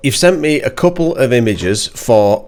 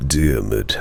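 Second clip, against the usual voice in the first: much lower, deep announcer voice on the name; voice-over voice; 12 semitones lower, 5 dB quieter than usual. Pretend. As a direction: say the name like a movie-trailer narrator. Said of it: "I got my, my voice over voice".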